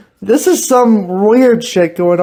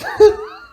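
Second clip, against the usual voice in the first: squeaky high giggle. Real, not acted. Laughing at own cluck.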